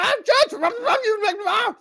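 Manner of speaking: high, hammed-up angry ranting; mock screaming gibberish. Mock of the lawyer yelling at the judge, high gibberish. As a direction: ham up an incoherent rant. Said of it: "He's screaming".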